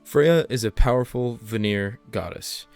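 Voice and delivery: ordinary talk, nothing marked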